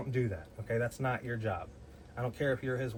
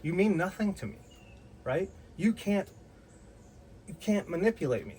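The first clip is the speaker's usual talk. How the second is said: retold angry retort; 4 semitones higher than usual. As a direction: put her in her place. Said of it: "And I said".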